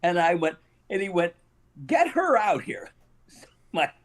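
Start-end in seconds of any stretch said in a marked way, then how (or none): 1.9-2.9 high mock holler; imitating an outraged summons; retold shouted order. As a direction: call it out high, as an imitated demand. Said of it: "at the top of his lungs".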